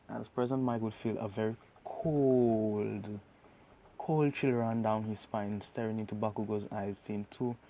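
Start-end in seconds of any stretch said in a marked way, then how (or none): none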